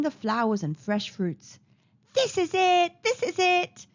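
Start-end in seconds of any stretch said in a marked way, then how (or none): 2.1-3.9 high, louder burst of delight; joyful cry. A narrator's reading. Boy finding the mangoes he wanted, joyful cry. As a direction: exclaim it high, jumping for joy. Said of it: "He jumped for joy".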